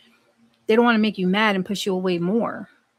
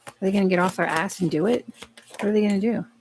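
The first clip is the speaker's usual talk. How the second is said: ordinary delivery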